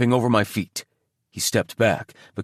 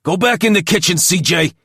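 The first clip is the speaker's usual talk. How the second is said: loud, higher angry snarl; snarled order; 8 semitones higher, 6 dB louder than usual. A narrator's reading. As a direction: snarl him back to the kitchen. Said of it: "Toby snarled".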